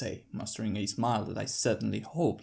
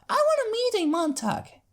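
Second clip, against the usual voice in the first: put-on high, louder eager little-girl voice; eager filly; 7 dB louder than usual. Apple Bloom eager to meet one, high eager filly. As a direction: beg it like an excited kid.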